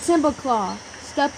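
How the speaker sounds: ordinary delivery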